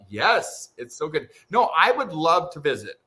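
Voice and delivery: ordinary talk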